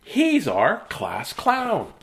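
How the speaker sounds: put-on high, slow childish sing-song; giggly kids. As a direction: chant it like delighted classmates. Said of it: "They said".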